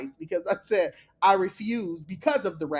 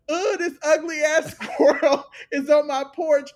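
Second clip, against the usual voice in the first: mock scream; 6 semitones higher, 6 dB louder than usual. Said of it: "she's screaming".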